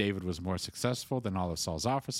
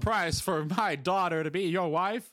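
game show host; 7 semitones higher, 5 dB louder than usual. Pretend.

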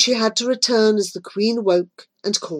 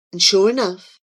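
plain reading voice

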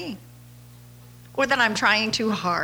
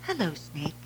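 softer gentle greeting; 4 semitones lower, 8 dB quieter than usual. Retold or imitated. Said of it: "he taught his son".

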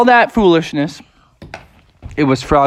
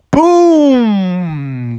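long drawn-out "boom"; sustained cartoon crash noise; booming impact; 4 semitones higher, 4 dB louder than usual. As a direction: imitate a big splashdown boom. Impact sound in the story.

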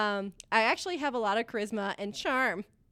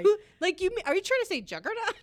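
high mocking voice; 10 semitones higher, 4 dB louder, faster than usual. Mocking stammer of the medic.

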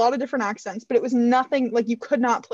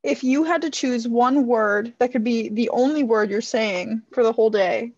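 ordinary delivery

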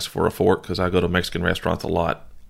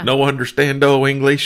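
slightly higher mock-Spanish phrase; retold mock Spanish; 5 semitones higher, 4 dB louder than usual. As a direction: put on clumsy fake Spanish for a retold line. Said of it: "And I was like".

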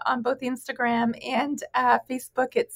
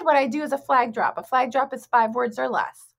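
normal talking voice

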